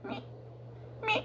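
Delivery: tiny broken mew; short, feeble kitten squeaks with gaps; imitated cat cry. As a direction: squeak out a faint, voiceless little meow. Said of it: "When she cries, it literally is like".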